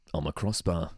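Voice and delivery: low, put-on British accent; mock British accent. Crossbar in man of the match interview, mock British accent. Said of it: "He just has, like, a British accent".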